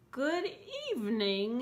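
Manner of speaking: high, silky monster greeting. A narrator's reading. Ralph the groomed monster greets the child, high and silky. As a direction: purr it up high and smooth. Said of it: "he said in a high, silky voice".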